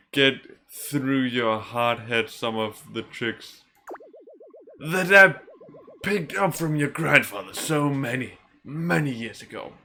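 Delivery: slow elder, straining for emphasis; put-on old man voice. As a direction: play the old mentor reminiscing. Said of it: "I don't think I can keep doing that voice".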